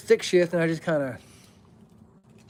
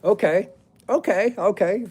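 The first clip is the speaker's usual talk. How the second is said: eager okays; 5 semitones higher, 5 dB louder, slower than usual. Real, not acted. Getting ready to tell favorite.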